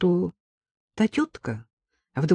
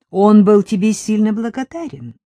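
sarcastic; 5 semitones higher, 8 dB louder than usual. A narrator's reading. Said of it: "С непередаваемым сарказмом".

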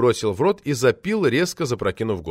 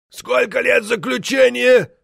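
high, slow shout; loud question; 13 semitones higher, 5 dB louder, slower than usual. A narrator's reading. Member asking about the sentence, loud and high. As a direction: shout it slowly as if for the hard of hearing. Half-deaf member asks loudly.

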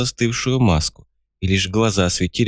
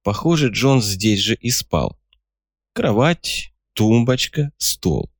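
plain reading voice

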